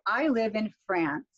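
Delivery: "I live in France" with the pitch dropping on "France"; falling intonation. Shown as a demonstration. Teacher modelling a declarative sentence for trainees, falling intonation. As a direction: let "France" fall to close the statement. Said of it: "Notice how I fall".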